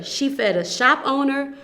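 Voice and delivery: ordinary delivery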